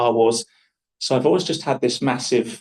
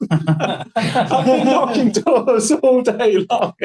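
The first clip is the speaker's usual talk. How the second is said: much higher, slow, laughing through the words; laughing exhausted; 13 semitones higher, 4 dB louder, much slower than usual. Retold or imitated. Missionary answering the homeowner, laughing and exhausted. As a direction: gasp it out, worn out and giggling.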